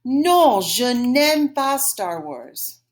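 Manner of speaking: slow, drawn-out French model; repeat-after-me model. Shown as a demonstration. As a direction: model it slowly for learners to repeat. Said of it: "One more time".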